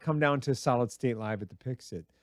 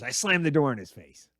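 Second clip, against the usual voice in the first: ordinary delivery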